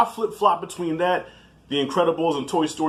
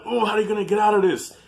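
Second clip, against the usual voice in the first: higher, mock-worried exclamation; retold anxious reaction; 7 semitones higher, faster than usual. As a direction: act out a viewer on edge. Voicing own anxious movie reaction.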